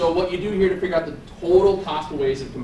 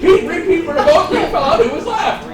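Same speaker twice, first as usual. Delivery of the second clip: laughing; 10 semitones higher, 8 dB louder than usual. Shown as a demonstration. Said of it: "repeats riddle faster, laughing".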